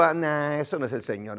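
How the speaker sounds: low, very quiet dismissive aside; incredulous. Retold or imitated. Doubter watching God move in church, incredulous low mutter. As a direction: wave it off under your breath. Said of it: "algo incrédulo".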